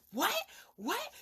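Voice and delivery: disbelieving squeak of surprise; shocked. Retold or imitated. Bria hearing the news, high shocked disbelief.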